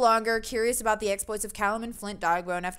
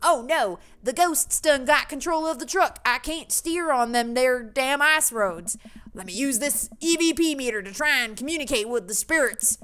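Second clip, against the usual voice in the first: louder, higher hammed-up Southern accent; 3 semitones higher, 3 dB louder than usual. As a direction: play a panicked Southern ghost hunter. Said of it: "most stereotypical Southern accent".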